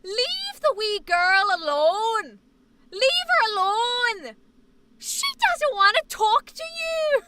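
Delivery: loud, high, drawn-out Irish scolding; Irish grandpa. Acted. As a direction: bellow protectively in a thick Irish accent. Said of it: "my grandpa would go".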